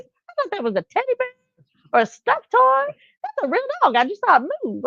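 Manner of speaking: very high-pitched mock disbelief; amazed stranger. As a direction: imitate someone squeaking in astonishment. Imitates stranger amazed at a live dog.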